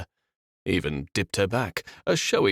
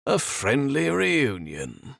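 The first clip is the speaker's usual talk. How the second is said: slow, higher mock-friendly lilt; lightly voiced lilting villain; 7 semitones higher, slower than usual. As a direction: savour the false warmth. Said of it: "said in a lilting voice".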